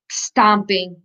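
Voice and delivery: ordinary delivery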